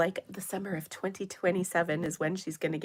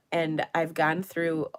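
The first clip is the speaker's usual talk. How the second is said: normal talking voice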